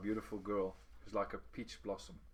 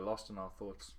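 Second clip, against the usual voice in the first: ordinary delivery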